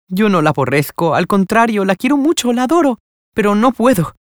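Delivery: ordinary delivery, nothing marked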